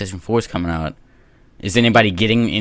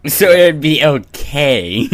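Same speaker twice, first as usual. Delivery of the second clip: mock repeat; 3 semitones higher, 5 dB louder, much slower than usual. Mocking repeat of friend.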